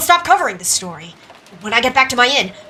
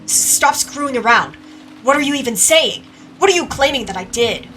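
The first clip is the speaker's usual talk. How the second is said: heated, stammering retort; angry protest; 5 semitones higher, 5 dB louder than usual. Acted. Accused man demanding what is claimed, angry. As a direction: protest the accusation with rising anger. Quarrel over murder accusation.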